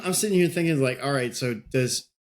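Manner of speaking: usual speaking voice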